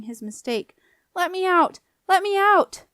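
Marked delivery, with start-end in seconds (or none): 1.1-2.9 high, loud, repeated plea; panicked shouting. A narrator's reading. Boy trapped behind the slammed door, panicked. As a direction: cry out to be freed.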